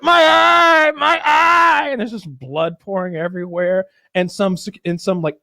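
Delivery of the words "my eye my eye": high, loud wail of pain; mock agony. Gangster hit in the eye with a bottle, mock agonized wail. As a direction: cry out as if just struck, hammed-up. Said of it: "the guy's just like".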